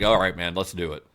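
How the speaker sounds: low, unimpressed deadpan reply; low-key deadpan agreement. Retold or imitated. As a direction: say it dryly, letting it drop away. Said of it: "he just deadpanned".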